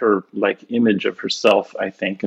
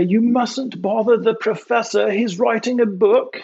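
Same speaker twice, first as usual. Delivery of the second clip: imitating a bad fake British accent, pitched much higher; mock fake British accent; 10 semitones higher than usual. Innkeeper shushing guests about the professor, in a hammed-up fake British accent. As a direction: put on a landlady's horrible fake British accent, high and prim. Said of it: "this British accent that was horrible".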